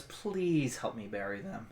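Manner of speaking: low, soft request; quiet plea. Acted. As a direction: ask for help softly and low. Quiet plea in game scene.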